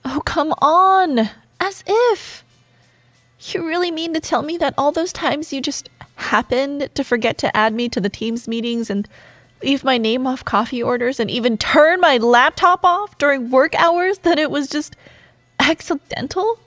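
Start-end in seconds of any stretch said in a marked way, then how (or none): 0.0-2.4 high, drawn-out scoff; exasperated disbelief. Acted. Scoffing at coworker's denial. 9.6-16.6 rising, louder incredulous rant; indignant accusation. Acted. Accusing rival of sabotage.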